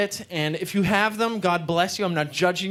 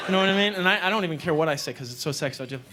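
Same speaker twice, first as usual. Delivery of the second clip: normal talking voice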